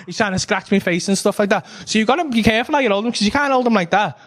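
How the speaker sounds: ordinary talk, nothing marked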